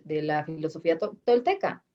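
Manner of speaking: ordinary talk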